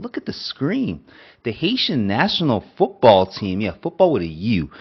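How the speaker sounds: big hyped-up reveal, pitch swooping up; excited announcement. Real, not acted. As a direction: announce it with loud, proud hype. Announcing big national team news.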